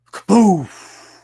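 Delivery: loud, high vocal boom; imitated explosion. Host blowing away the squeaky voice, imitated explosion. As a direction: blast it out like a cartoon bang. Imitated blast after silencing voice.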